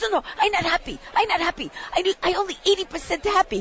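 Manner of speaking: a light put-on accent of a fussy photographer; imitating Japanese accent. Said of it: "She goes".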